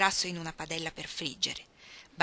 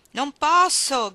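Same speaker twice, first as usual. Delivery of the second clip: slow, much louder and higher tired yell; weary shout; 7 semitones higher, 11 dB louder, much slower than usual. A narrator's reading. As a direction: shout it back, worn out and slow. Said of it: "gridò stancamente il vecchio".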